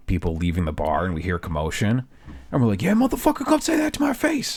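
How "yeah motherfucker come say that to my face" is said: high hammed-up bar brawler yell; mock a drunk picking a fight; drunk tough guy. Drunk guys yelling outside bar.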